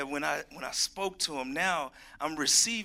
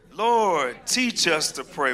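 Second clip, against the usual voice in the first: louder, slower, each word driven home; with conviction; 2 semitones higher, 8 dB louder, much slower than usual. Shown as a demonstration. Pastor urging the congregation to repeat with conviction, loud and firm. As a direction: pray it out like you mean it. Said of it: "say it with some conviction".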